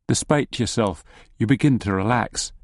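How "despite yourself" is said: usual speaking voice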